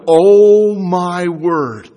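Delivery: loud, slow gasp of awe; as if seeing the throne of God for the first time; drawn-out amazed exclamation; awestruck. Retold or imitated. John awed before the throne.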